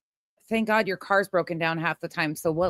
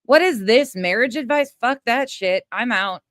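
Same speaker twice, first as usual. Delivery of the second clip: exasperated outburst; 2 semitones higher, 8 dB louder than usual. Real, not acted. Host fed up with marriage advice from Betty, exasperated outburst. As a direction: blow up in sheer impatience.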